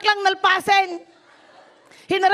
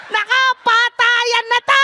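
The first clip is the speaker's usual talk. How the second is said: loud exclaim; 7 semitones higher, 6 dB louder, slower than usual. Real, not acted. Comic exclaim at sudden wedding.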